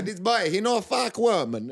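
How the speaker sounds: put-on repeating the coach, slow Brazilian accent. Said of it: "He's just there".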